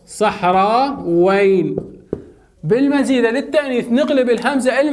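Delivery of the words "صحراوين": صحراوين very slow, each part held long; drawn-out demonstration. Shown as a demonstration. Teacher answers own question for students, slow drawn-out demonstration. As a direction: stretch صحراوين right out.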